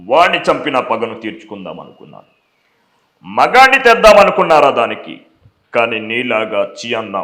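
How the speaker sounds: movie villain swinging from low menace to roars; hammed-up film villain confrontation; Sampath as Mirchi villain. Shown as a demonstration. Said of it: "ఆయన ఎలా మాట్లాడతారంటే".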